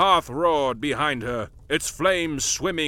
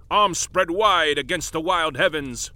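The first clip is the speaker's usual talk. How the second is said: usual speaking voice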